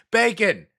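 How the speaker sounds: Bacon. called out high and loud; excited high Bacon. Real, not acted. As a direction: burst out with Bacon. in delight.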